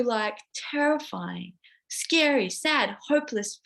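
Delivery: usual speaking voice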